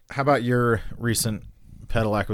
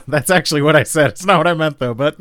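flustered defense; 6 semitones higher, 7 dB louder than usual. Real, not acted.

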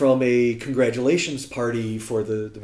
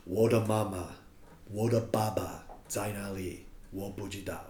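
quoting solemn grief, voice dropping softer; hushed solemn Mandarin, fading away; solemn, quiet; 4 semitones lower, 10 dB quieter than usual. Retold or imitated. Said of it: "him very solemnly saying".

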